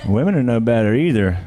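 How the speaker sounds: ordinary delivery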